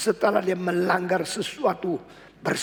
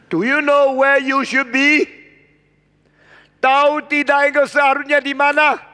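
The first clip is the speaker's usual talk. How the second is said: forceful preaching; 9 semitones higher, 7 dB louder than usual. Acted. A preacher presses the congregation, loud and high. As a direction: drive the question home.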